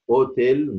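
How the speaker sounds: اوتيل begun on the vowel "o", no H; starts on O. Shown as a demonstration. Teacher demonstrating silent H, "otel" starting on O. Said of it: "نطق بادئ من الاو".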